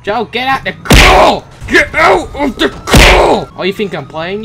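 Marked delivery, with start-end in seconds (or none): 0.0-1.4 shouting order. Acted. Ordering NPC out of car. 1.7-3.5 drawn-out, loud, higher yell; slow shout. Acted. Escalating yell at NPC.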